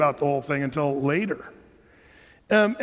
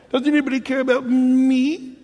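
higher, louder self-pitying plea; mock whining; 6 semitones higher, 4 dB louder than usual. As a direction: play a pouting king begging for attention. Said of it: "That's what he's doing".

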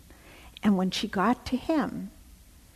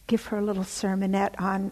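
ordinary talk, nothing marked

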